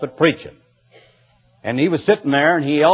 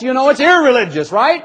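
mock cursing voice, higher; irreligious tone; 10 semitones higher than usual. Shown as a demonstration. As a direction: swear it like on the golf course. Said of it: "in a different tone on the golf course".

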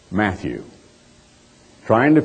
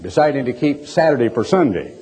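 ordinary talk

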